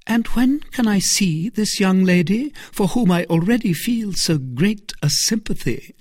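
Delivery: a hint of feigned sympathy; Milady. A narrator's reading. Milady asks sweetly.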